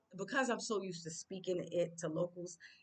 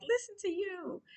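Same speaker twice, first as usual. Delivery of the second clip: teasing quote; 3 dB louder than usual. Retold or imitated. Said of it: "some people hear me and they're like".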